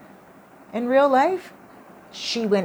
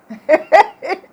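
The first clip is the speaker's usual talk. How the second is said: ghost's laugh; 10 semitones higher, 13 dB louder than usual. Retold or imitated.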